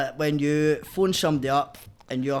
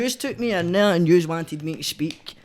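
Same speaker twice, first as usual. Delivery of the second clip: higher and louder indignant protest; retold protest; 6 semitones higher, 9 dB louder than usual. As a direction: fire back at the police, outraged. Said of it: "And I was like".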